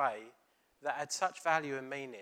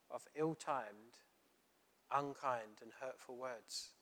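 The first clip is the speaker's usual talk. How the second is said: slow and softer, each word laid down; slow and weighty; 6 dB quieter, slower than usual. Real, not acted. Preacher warning the congregation, slow and grave. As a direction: drag the words out gravely.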